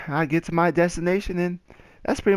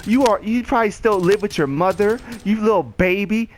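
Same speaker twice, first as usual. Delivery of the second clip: higher-pitched retold taunt; mock taunting; 5 semitones higher than usual. Woman mocking the man as a mama's boy, taunting. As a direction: imitate her belittling him.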